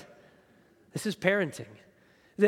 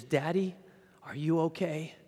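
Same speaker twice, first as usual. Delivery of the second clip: little daughter's voice. Retold or imitated. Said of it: "she goes".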